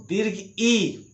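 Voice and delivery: दीर्घ ई drawn out slowly; long ee. Shown as a demonstration. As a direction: hold ई as a long "ee". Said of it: "यानी ये वाला ई". (धीमी, खिंची हुई दीर्घ ई)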